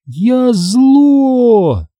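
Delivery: high, drawn-out spooky wail; ghostly moan. A narrator's reading. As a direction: stretch it into an eerie high moan. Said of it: "а потом тихие стоны".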